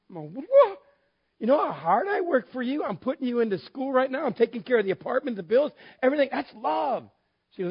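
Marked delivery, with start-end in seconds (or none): 0.3-0.8 very high, loud comic yelp; hammed-up, high-pitched astonishment; shocked disbelief. Acts out his reaction to wife. 1.4-7.1 high-pitched, exasperated self-justification; pitched up, acted protest; indignant defense. Acts out defending himself to wife.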